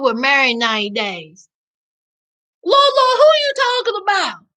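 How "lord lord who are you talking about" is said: high-pitched, bewildered outcry; high, exclaiming bafflement; baffled exclamation. Real, not acted. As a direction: cry it out in disbelief, pitched way up. Baffled by a viewer's question.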